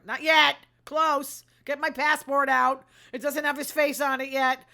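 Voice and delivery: sarcastic outburst. Real, not acted. Sarcastic outburst about press freedom.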